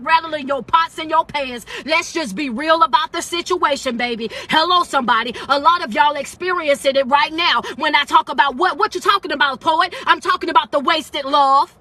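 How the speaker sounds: ordinary talk, nothing marked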